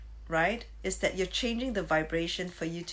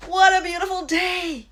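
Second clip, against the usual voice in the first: much higher and louder, beaming; joyful exclamation; 12 semitones higher, 12 dB louder than usual. Shown as a demonstration. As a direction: shout it to the morning with delight. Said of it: "wake up exclaiming".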